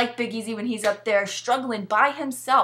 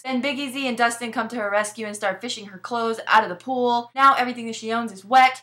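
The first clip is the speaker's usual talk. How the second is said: ordinary talk, nothing marked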